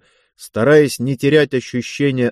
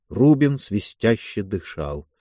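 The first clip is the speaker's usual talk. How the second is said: ordinary delivery, nothing marked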